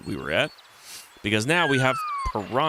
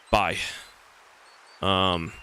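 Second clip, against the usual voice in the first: usual speaking voice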